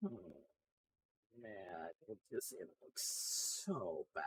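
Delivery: deep moan. Real, not acted. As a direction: let it sag into a low moan. Moaning despair at icons.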